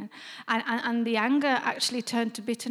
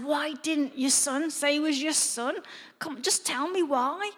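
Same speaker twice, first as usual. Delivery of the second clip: mild mock yell, raised pitch; retold shouting at God; 4 semitones higher than usual. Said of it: "and shouting at God".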